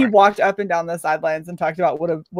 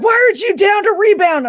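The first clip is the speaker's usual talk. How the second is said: loud, much higher coach yell; 11 semitones higher, 5 dB louder than usual. Retold or imitated. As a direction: bellow at the player from the sideline. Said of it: "he was like".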